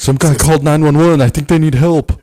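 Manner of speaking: loud mock-worried caller reporting an emergency; mock frantic caller. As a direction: blurt it like a frightened bystander. Acted caller panicking on phone.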